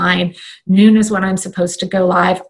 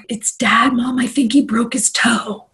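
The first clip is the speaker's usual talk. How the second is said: higher hushed whisper; whispered child; 4 semitones higher than usual. Retold or imitated. Daughter whispering to mom that dad broke his toe. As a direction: whisper it like a scared kid. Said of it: "she's like".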